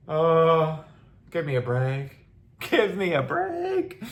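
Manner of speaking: high, slow, drawn-out give me a break; exasperated scoff, pitch climbing on the repeat. Real, not acted. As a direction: stretch it out in disbelief. Exasperated reaction to question.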